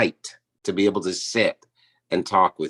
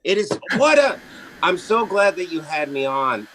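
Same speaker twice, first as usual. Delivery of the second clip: much higher gushing voice; mock gushing; 9 semitones higher than usual. Guest thanking host in a prissy voice, much higher. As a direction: gush like a society lady.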